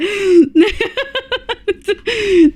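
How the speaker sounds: giggling fit. Real, not acted. Streamer still giggling after apologizing to chat. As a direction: keep laughing at the mistake.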